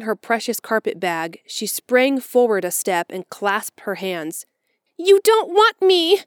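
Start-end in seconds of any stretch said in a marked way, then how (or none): 5.0-6.2 put-on high, loud, slow girl's wail of dismay; a child cries out as it dawns on her; crying out. A narrator's reading. Orphan girl to Marilla and Matthew, crying out high and loud. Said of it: "she cried".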